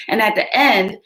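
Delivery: plain reading voice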